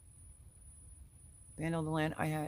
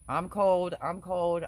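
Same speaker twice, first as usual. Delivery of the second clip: mock complaining; 4 dB louder, slower than usual. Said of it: "I'm that person that does that".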